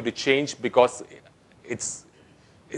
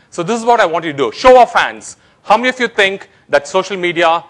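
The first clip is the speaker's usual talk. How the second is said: fast, rising to a loud call for hands; rally the audience for a show of hands; brisk loud call to a crowd; calling out to the room; 5 semitones higher, 5 dB louder than usual. Real, not acted.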